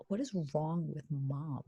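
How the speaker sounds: low, soft, slow murmur; baby's unimpressed aside. Retold or imitated. One-year-old wonders about crying mother, quiet unimpressed aside. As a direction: quietly voice a baffled baby's thoughts. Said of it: "my kid at a year old is like".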